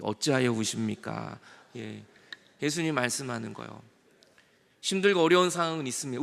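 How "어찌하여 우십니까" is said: slow, low, measured question; demonstrated greeting. Shown as a demonstration. Preacher showing congregation how to greet neighbours, slow low question. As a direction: draw the question out slowly in a lowered voice. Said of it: "이렇게".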